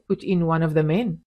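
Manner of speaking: plain reading voice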